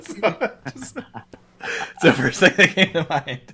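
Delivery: real laughter through the words, high and loud; laughing. Real, not acted. Host cracking up at own Seinfeld comparison, laughing. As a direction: talk through a big laugh, pitch jumping up.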